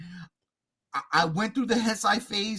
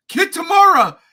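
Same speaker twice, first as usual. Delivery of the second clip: excited; 6 semitones higher, 5 dB louder than usual. Real, not acted. Excited rant at director.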